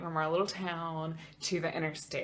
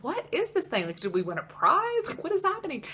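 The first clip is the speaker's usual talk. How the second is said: much higher, incredulous questions; baffled disbelief; 9 semitones higher than usual. Retold or imitated. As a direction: pitch it up in bewildered disbelief. Baffled by the fine slip.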